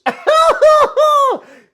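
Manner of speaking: big high burst of laughter; shrieking laugh; loud laugh. Real, not acted. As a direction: cackle out loud at the clip. Laughing at Justin clip.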